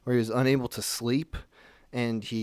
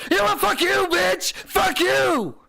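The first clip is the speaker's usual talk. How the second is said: very high, loud screamed outburst; full-on screaming; 6 dB louder than usual. Retold or imitated. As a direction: imitate a man suddenly screaming abuse. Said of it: "Full on screaming".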